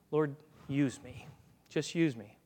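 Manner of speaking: low, slow, soft plea; humble prayer. Acted. Willing believer praying to the Lord, humble and hushed. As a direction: offer yourself quietly, voice dropped.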